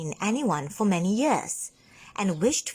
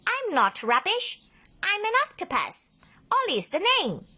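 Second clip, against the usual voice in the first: high, cheeky octopus introducing himself; 13 semitones higher than usual. A narrator's reading. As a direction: pop out and introduce yourself. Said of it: "he said".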